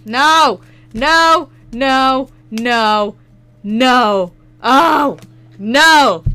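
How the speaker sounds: loud, high repeated shouts of no; hammed-up outraged yelling; mock despair. Real, not acted. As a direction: yell no at the card in comic dismay. Pulls an unwanted Stunfisk card.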